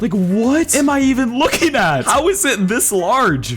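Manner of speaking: high-pitched incredulous outburst; amazed, voice pushed up high. Real, not acted. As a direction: question it in sheer disbelief. Marvels at the giant plant.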